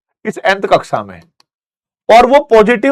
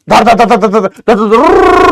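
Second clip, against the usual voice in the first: rapid rattling sound effect; 3 dB louder, slower than usual. Retold or imitated. Stones flying in movie.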